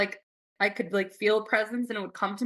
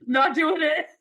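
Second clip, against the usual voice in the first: high, louder, laughing protest; laughing refusal; 7 semitones higher, 4 dB louder than usual. Retold or imitated. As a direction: laugh while refusing, pitched up. Laughing refusal in ghost story.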